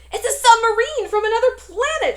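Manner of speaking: high, loud panicked shriek. A narrator's reading. Terrified coach shrieks at the thing in the pool. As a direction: scream it as if the pool holds a monster. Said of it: "shrieked the coach".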